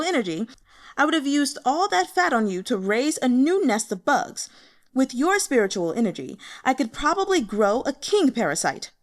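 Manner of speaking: loud, high, sneering threat; angry threat. Acted. Bug master threatening fat Yuan, loud and sneering.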